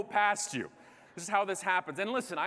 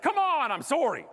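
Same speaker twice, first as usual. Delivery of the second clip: high, loud burst falling into sorry; outburst turned apology; 8 semitones higher, 5 dB louder than usual. Retold or imitated. Canadian swearing at attendant and instantly apologising, exasperated then contrite. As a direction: snap out the curse, then apologise at once. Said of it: "I immediately apologized after the words came out".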